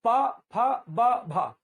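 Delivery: प फ ब भ each said alone with a pause, loud, lips popping; model lip consonants. Shown as a demonstration. Teacher asks the class to repeat: प फ ब भ, clear and loud. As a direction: drill प, फ, ब, भ with strong puffs of air. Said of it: "ये हो गया पहला".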